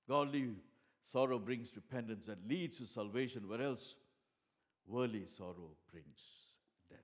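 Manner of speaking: loud, high declaration that slows on worldly; loud preached proclamation; proclaiming scripture. Acted. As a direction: ring out the verse from the pulpit. Preacher's key verse proclaimed.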